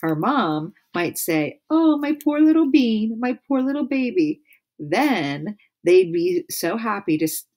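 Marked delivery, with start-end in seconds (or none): 1.7-4.4 high, cooing pity; mother imagined fussing over her lost child, high cooing; doting mom. Acted. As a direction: coo over the poor baby.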